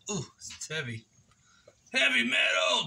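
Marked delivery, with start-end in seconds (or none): 1.9-2.9 shout. Real, not acted. Vlogger punning on heavy wood, shouted high. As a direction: blurt it like a rock cry.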